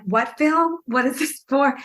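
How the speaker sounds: high-pitched, incredulous questions; retold bafflement. Actress replaying the surprise casting call, baffled and high-pitched. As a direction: act out being completely thrown.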